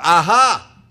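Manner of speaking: very loud, triumphant outburst; gotcha. Acted. Angel catching the woman seeking praise, loudly triumphant. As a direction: burst out as if catching someone out. Angel pounces on second admission.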